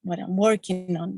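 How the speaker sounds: ordinary talk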